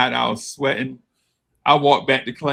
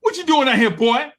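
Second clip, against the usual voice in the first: teacher voice; 11 semitones higher, 7 dB louder than usual. Retold or imitated. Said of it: "Mister Williams is like".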